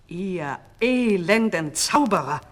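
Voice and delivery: slow, loud contemptuous address; angry call. A narrator's reading. King to the unmasked sorcerers, angry call. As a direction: drag out the insult loudly. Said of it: "rief der König".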